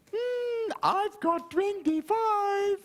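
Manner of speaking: put-on a proud small child showing off. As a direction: James tells the teacher his amount, high and slow. Pupil James proudly reports.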